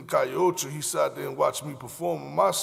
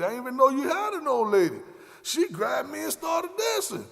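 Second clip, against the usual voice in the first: defensive protest; 10 semitones higher than usual. Retold or imitated. Telling Flo the dancer grabbed him, rising defensive shout. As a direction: plead innocence hard. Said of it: "I said".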